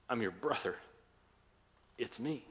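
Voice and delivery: quiet, understated emotional reveal; softly, as if after breaking down; gentle, hushed confession with a touch of tears; retold tearful confession. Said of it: "starts crying".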